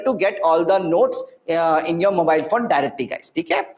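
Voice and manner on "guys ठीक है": ordinary talk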